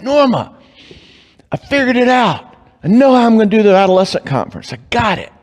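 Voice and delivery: high, eager burst of news; calling out thrilled to his wife; excited announcement. Retold or imitated. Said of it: "and I'm like".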